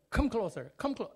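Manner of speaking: high, loud call across the room; father summoning his frightened son; calling closer. Retold or imitated. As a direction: call the boy over, insisting.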